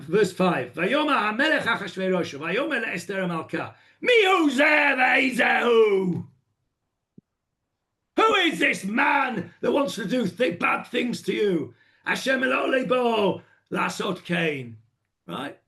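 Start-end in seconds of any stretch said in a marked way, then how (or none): 0.7-6.2 angry king. Shown as a demonstration. Achashverosh demanding who threatens Esther, angry. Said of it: "You're really angry". 8.2-14.8 higher, raised angry voice; angry king. Shown as a demonstration. King asking who would harm Esther, angry. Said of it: "You're really angry".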